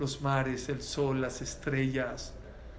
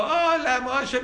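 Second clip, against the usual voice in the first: cheery hello; 8 semitones higher, 8 dB louder, much slower than usual. Retold or imitated. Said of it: "Si al otro le hablo".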